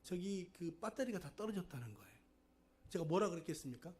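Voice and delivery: usual speaking voice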